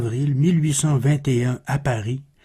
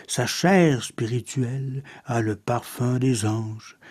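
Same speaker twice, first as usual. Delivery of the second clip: measured, softer poem reading with long pauses; poetry recitation; 4 semitones lower, 4 dB quieter than usual. Acted. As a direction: recite verse in a lower-key, solemn delivery. (récitation poétique posée)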